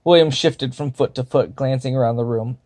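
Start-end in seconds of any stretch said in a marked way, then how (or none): none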